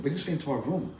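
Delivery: ordinary talk, nothing marked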